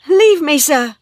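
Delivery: high, loud cry of alarm. A narrator's reading. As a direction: cry out to push him off. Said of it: "she cried".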